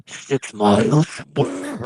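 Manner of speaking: mishear it slowly like a dazed stranger. Retold or imitated. Drifter mishears score.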